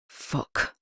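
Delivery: whispered curse under the breath; a detective cursing to himself after the call, whispered; soft curse. A narrator's reading. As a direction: breathe the swear word to himself. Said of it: "he said softly to himself".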